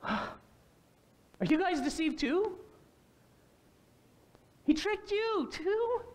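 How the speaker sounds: high, slow, hammed-up disbelief; mock shock. Pharisees mocking their officers with high, slow, hammed-up shock. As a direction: drag it out, appalled they fell for it.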